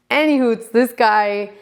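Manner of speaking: usual speaking voice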